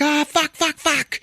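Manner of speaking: very high-pitched mock cries of pain; hammed-up squealing, imitating sudden agony; panicked yelping. Man bursts into flames in gasoline puddle, panicked yelping.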